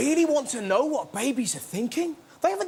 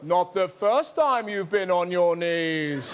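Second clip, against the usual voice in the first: devil's taunt; 6 semitones lower, slower than usual. Acted. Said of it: "a voice just goes".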